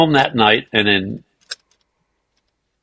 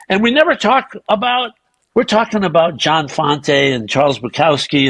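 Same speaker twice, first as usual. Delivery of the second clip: ordinary talk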